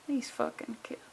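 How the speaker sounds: exasperated whisper under the breath; fed-up whispered swear; whispered aside. Real, not acted. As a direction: hiss it to yourself. Muttered aside at the kids.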